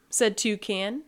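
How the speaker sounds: ordinary delivery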